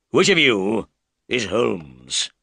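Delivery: slow, menacing big man's voice; a hint of a brutish bully; rude demand. Roylott bursts in and rudely demands Holmes, slow and menacing. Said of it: "he asked rudely".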